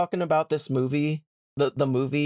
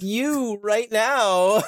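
very slow, louder, mock-creepy; sinister mock reveal; 7 semitones higher, 4 dB louder, much slower than usual. Faun telling Lucy she is the kidnap victim, slow and creepy. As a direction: drag it out like a creepy confession. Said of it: "he's like".

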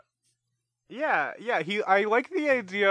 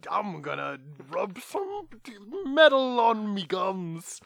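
put-on higher, louder, slow old-timey colonial accent as Benny Franklin; Founding Fathers accent; 5 semitones higher, 5 dB louder than usual. Cohost playing Benjamin Franklin to mock his dental habits, in a hammed-up Founding Fathers accent. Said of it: "It's the Founding Fathers accent".

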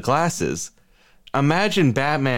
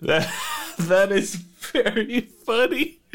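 normal talking voice

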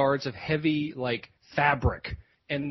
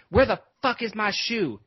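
mock outrage, pitched up; higher, exasperated quoted outburst; retold angry demand; 6 semitones higher than usual. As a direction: imitate an indignant man demanding his property. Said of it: "he was, he was pissed".

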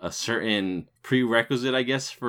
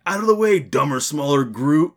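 loud, high mock yell; imitated scream; 7 semitones higher, 7 dB louder than usual. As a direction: yell it out like the movie character. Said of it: "And then he screams".

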